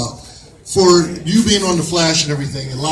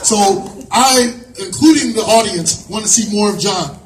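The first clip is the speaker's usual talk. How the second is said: ordinary delivery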